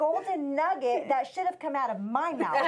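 high, loud comic indignation; hammed-up fury with laughter around it; mock mad. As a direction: play up the wounded outrage. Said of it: "And I was so mad".

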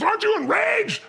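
high, incredulous mock outburst; acted heated question; imitated enraged partner. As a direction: demand it like an outraged friend. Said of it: "he's so much more intense".

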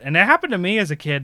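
normal talking voice

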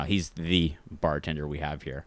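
"the" set apart as the one and only; stress on THE. Retold or imitated. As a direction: lean on "the" before "bartender". Singles him out as THE bartender.

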